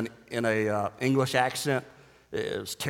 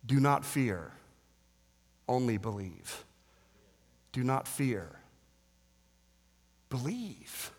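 hushed pastoral comfort; quiet, tender plea; 8 dB quieter than usual. Real, not acted. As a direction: lean in and comfort them. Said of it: "Can I say this to some of you?".

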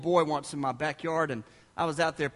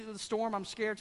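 put-on quiet, mildly frightened little girl; imitated scared child; 2 semitones higher, 8 dB quieter than usual. Little girl tells parents about the storm, mild fright. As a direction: lightly mimic a child explaining her fear. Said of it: "she's crying".

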